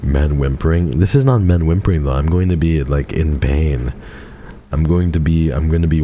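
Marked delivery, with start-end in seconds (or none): none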